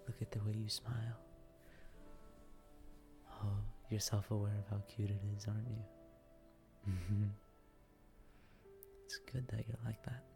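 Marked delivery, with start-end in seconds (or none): none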